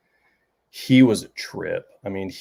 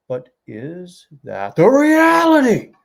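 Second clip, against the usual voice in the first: imitating the teacher, slow, higher and louder; raised voice; 8 semitones higher, 4 dB louder, slower than usual. Teacher challenging the class, slow rising loud question. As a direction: lift the question up big and slow. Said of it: "he would raise and lower his voice".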